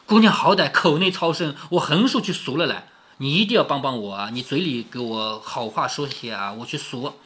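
an understated begging that trails down low and soft; pleading. A narrator's reading. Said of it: "只好在后面不停地央求".

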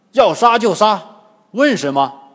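loud defiant shout; Sha Monk's shout. A narrator's reading. Sha Monk defying the old demoness, loud shout. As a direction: shout it out in short bursts. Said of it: "沙和尚大喝".

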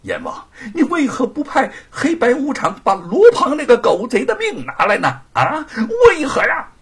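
high, loud, ending in a cry; anguished demand. Acted. Monkey King demanding why the killer lives, near tears. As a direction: demand justice through rising tears.

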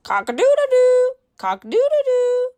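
high, loud, quick rooster crow. Pretend. Teacher crowing for the children like a rooster, high and loud. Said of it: "He can sound like a rooster".

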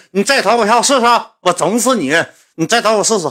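high-pitched, worked-up mock threat; mock fighting words, pitched high; acted angry threat. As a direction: imitate a riled kid daring someone.

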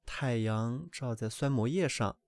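plain reading voice

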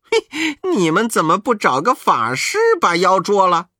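cheeky monkey. Acted. Monkey King asks the old man, high and cheeky. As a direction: needle them with the question. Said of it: "悟空问".

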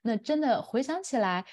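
ordinary talk, nothing marked

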